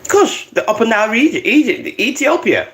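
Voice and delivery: quick, high-pitched excitement; all excited. Real, not acted. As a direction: rattle off the places eagerly. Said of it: "I got all excited".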